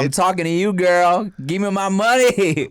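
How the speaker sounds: hammed-up collector shouting for cash; mock angry demand. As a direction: lean on her for the money.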